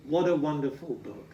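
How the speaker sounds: plain reading voice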